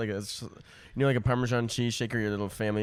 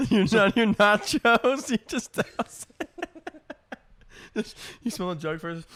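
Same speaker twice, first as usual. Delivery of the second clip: high, slow words through laughter; laughing; 10 semitones higher, 4 dB louder than usual. Real, not acted. Co-host picturing milk-doused nachos, laughing helplessly. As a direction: struggle to speak while cracking up.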